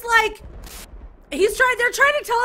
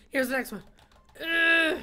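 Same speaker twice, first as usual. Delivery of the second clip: usual speaking voice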